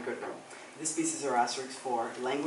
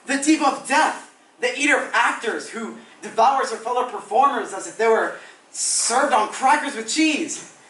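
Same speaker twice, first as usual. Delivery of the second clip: higher, theatrical outrage; melodramatic indignant diva; 9 semitones higher, 11 dB louder than usual. Acted. Actor warning Anthony about Camille, overblown outrage. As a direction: denounce a rival with grand horror, stretching key words.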